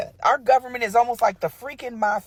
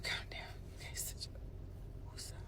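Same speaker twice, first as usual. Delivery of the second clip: hushed whisper to calm herself; 24 dB quieter, slower than usual. Real, not acted. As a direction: whisper it to settle down. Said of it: "let me calm the heck down".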